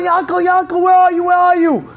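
high, urgent calling out; high-pitched searching yell; megaphone scream. Acted. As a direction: keep shouting for the lost boy. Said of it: "he screams out".

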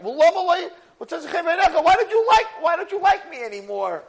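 high, loud, drawn-out complaint; anguished plea. Retold or imitated. Teacher as Moshe complaining to Hashem, anguished plea. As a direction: cry it out in protest to God.

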